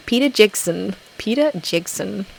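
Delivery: attempted New Zealand accent, vowels squeezed. Shown as a demonstration. Greeting a viewer from New Zealand, attempted New Zealand accent. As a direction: try your best New Zealand accent. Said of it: "That's my best New Zealand accent".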